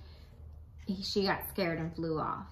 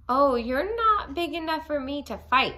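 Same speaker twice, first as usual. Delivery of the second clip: grouchy retort; 6 semitones higher, 7 dB louder than usual. A narrator's reading. Grouchy ladybug backs down.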